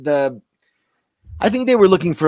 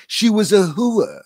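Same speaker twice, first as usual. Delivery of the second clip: a tough mobster growl, "She was a hua.", slow and higher; mobster quote; 7 semitones higher, 4 dB louder, slower than usual. Retold or imitated. Quoting Joey's Sopranos character, slow hammed mobster voice. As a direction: drawl it like a Sopranos wiseguy. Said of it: "his character was like".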